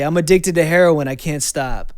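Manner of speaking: sudden loud confession to mom and stepdad; blurted confession. Retold or imitated. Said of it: "Out of nowhere, I just say".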